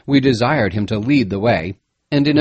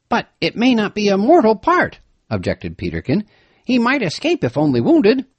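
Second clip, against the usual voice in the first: worried Peterkin; 9 semitones higher than usual. A narrator's reading. Peterkin objecting.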